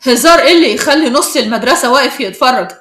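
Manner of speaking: loud, stern challenge; sharp. A narrator's reading. Adam challenges the girls over their joking, sharp and loud. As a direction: demand an explanation sharply. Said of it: "وقال بحدة".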